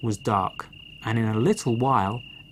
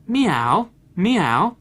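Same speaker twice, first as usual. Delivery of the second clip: high, loud playful meows; a storyteller's cat meow; 6 semitones higher, 5 dB louder than usual. A narrator's reading. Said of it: "And the cat mewed".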